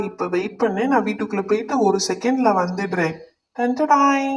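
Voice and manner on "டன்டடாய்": high, bright ta-da announcement; ta-da reveal. Acted. Brother reveals the cooking set to the girls, showy ta-da. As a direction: present the surprise with a flourish.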